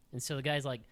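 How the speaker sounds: ordinary talk, nothing marked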